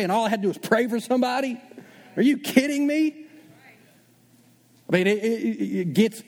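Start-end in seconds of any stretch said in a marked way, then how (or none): 0.0-3.3 rising to an incredulous high cry; amazed disbelief. Retold or imitated. New believer stunned that prayer worked, incredulous.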